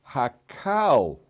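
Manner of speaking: Hakal, slowly, with an open AL ending; read as Hakal. Shown as a demonstration. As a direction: stretch out the second syllable of Hakal. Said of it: "So it's read as".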